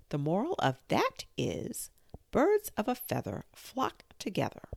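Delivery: a hint of a preachy Duchess. A narrator's reading. Duchess moralizing.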